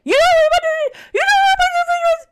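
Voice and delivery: very high, loud, fast wail; both aghast that she never saw the film; outraged disbelief. Retold or imitated. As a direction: cry it out in mock horror.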